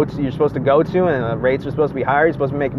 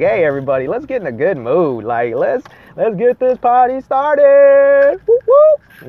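rising to a high, loud party whoop; rally the room, ending on a cheer; party-starting drunk; 9 semitones higher, 5 dB louder than usual. Retold or imitated. Happy drunk rallying everybody, building to a loud whoop. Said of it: "I'm like".

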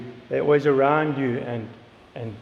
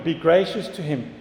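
ordinary talk, nothing marked

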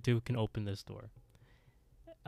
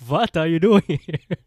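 put-on booming, higher Transylvanian accent; hammed-up Transylvanian, very loud; 6 semitones higher, 13 dB louder than usual. Landlord surprises tenant at the door, booming Transylvanian accent. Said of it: "He's from Transylvania now".

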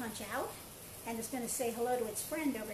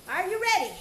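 louder, higher rallying call; loud coach hype; 5 semitones higher, 8 dB louder than usual. Shown as a demonstration. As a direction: pump up the class.

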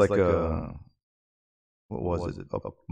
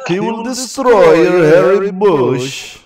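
higher, louder hammed-up Russian accent; mock Russian threat; 8 semitones higher, 8 dB louder, slower than usual. Cohost mocking the Russian fighter's threat to his opponent, hammed-up Russian accent. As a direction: growl it like a cartoon Russian villain.

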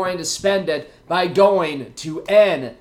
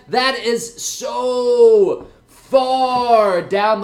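drawn out; 5 semitones higher, 4 dB louder, much slower than usual. Acted. Stressing how distant the plan is.

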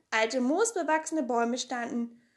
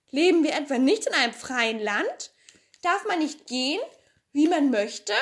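put-on girl's voice, louder, boastful and sure of herself; cheeky Pippi; 4 semitones higher, 5 dB louder than usual. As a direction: play the bold girl who knows the whole world.

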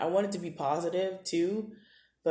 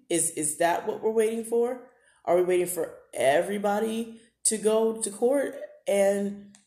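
building, higher-pitched preacher-style plea, each question pushing harder; impassioned preaching; 3 semitones higher than usual. Acted. Podcaster urging listeners to act on racism, preaching with rising passion.

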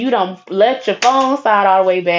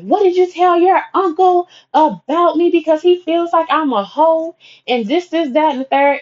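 mocking angry friend; 10 semitones higher than usual. Angry friend confronting her about uncle's rumors. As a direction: pitch it way up and accuse.

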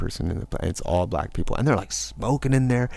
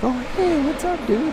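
much higher, breezy hey dude; bright greeting. Retold or imitated. Running into Jason, much higher breezy greeting. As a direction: greet an old acquaintance cheerily.

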